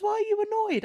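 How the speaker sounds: put-on very high, clueless boyfriend voice; high-pitched imitation of his bewilderment; imitated baffled boyfriend. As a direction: pitch it up in mock-innocent puzzlement. Boyfriend baffled by her annoyance.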